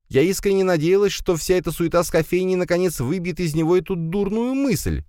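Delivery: high-pitched running complaint; motherly grumbling. A narrator's reading. Mother grumbles about son's cafe dream, complaining. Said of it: "проворчала она".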